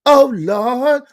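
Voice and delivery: high exasperated cry; oh lord. Real, not acted. Host reacting to the born-again virgin claim, exasperated. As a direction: throw it up high in mock despair.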